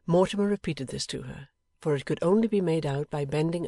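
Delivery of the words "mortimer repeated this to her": plain reading voice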